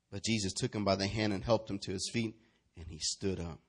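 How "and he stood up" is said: normal talking voice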